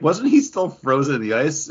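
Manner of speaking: skeptical inmate. Retold or imitated. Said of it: "And it's like".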